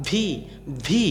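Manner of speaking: English "vee" for ভি; lip-to-teeth V. Shown as a demonstration. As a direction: buzz the v, then release into a long ভি. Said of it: "এইরকম উচ্চারণ হবে".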